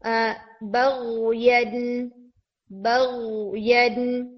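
बग़यन said very slowly with a voiced throaty ghain; slow tajweed demo. Shown as a demonstration. Teacher slowly demonstrating Quranic word. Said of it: "जैसे for example word आता है".

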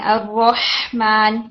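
الرحمن recited slowly with the ha sound drawn out; slow tajweed recitation of الرحمن; recitation demo. Shown as a demonstration. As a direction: let the ha in الرحمن run on. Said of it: "ہا میں تھوڑا رن کرتے ہیں".

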